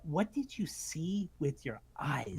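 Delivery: a pointed whisper, slow; hushed rhetorical jab; whispered challenge. Real, not acted. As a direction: lean in and whisper the question.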